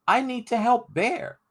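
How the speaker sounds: put-on high, earnest boy's plea; a boy begging to save his friend; pleading boy. Crispin tells John Ball he must help Bear, high pleading.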